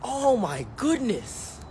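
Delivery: slow, high, drawn-out amazement; stunned awe. Retold or imitated. Comic stunned after the owner shoots the robbers, slow awe. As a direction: breathe it out in disbelief. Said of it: "I'm like".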